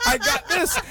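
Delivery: ordinary talk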